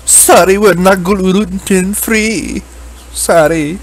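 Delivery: put-on slow, high Indian accent. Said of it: "I had an Indian voice I used to do".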